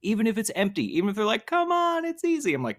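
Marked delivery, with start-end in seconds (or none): 1.5-2.5 high, sing-song coaxing voice; retold friend coaxing, pitched way up; mock tempting. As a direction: tease like a friend luring you in. Friends tempting him to cross.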